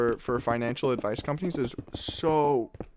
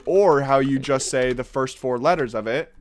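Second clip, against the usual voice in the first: ordinary talk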